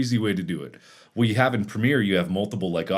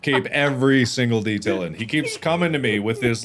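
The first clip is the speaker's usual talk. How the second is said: ordinary talk, nothing marked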